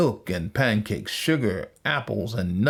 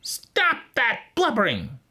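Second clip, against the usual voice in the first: high-pitched witch reprimand; scolding witch; 13 semitones higher than usual. A narrator's reading. Witch to wailing Gretel, high scolding voice. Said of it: "said the witch".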